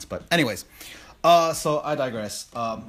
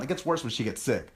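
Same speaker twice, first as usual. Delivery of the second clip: ordinary delivery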